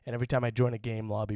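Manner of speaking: ordinary talk, nothing marked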